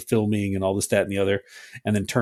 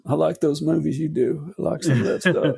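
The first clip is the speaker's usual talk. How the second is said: higher-pitched politician impression; Bill Clinton; 5 semitones higher than usual. Podcaster playing the former president praising her movies, Clinton impression. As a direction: put on a high, schmoozing politician voice. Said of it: "My Bill Clinton sound".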